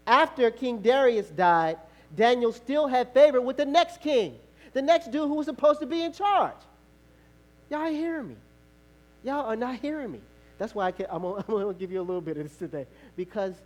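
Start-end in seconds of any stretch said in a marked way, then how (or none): 0.1-1.8 loud, slow, raised preacher's voice; emphatic preaching. Real, not acted. Preacher hammering home a Bible story, emphatic. 2.2-4.4 loud, high-pitched proclamation; emphatic preaching. Real, not acted. Preacher restating the point to the congregation, emphatic. 4.7-10.2 raised, high preacher's pitch; emphatic preaching. Real, not acted. Preacher insisting that the congregation hear him, emphatic.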